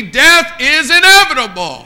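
high, loud pulpit proclamation; preacher's cry. Real, not acted. Preacher warning sir and ma'am in the pews, loud preacher's cry. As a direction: drive it home at full voice. Repeats it louder to the congregation.

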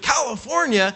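surprised. Retold or imitated. Stranger reacting to California.

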